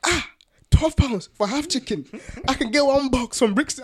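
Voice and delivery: high, loud mum impression complaining about prices; imitating his mum. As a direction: play a thrifty mum shocked at the bill, high and loud. Mum quoted complaining at Nando's.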